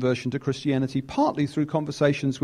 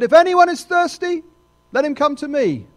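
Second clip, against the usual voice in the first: louder and higher, ringing out the call; proclaiming; 9 semitones higher, 7 dB louder than usual. Acted. Preacher calling out Jesus' invitation, louder and higher. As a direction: preach it like an open invitation to everyone.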